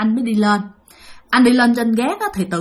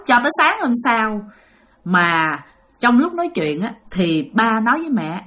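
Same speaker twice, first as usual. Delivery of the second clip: plain reading voice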